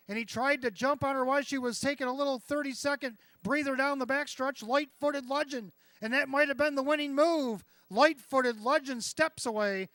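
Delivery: loud, high stretch call; excited race caller. Real, not acted. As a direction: build to a roaring finish. Stretch drive building.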